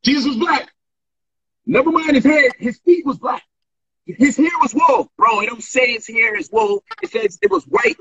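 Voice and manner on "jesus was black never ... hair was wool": mocking the pastor.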